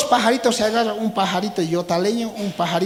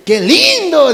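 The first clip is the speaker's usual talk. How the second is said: much higher and louder, thrilled; delighted cry; 9 semitones higher, 10 dB louder, slower than usual. Acted. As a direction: shriek it with delight. Animals admire flying frog. (grito de encanto)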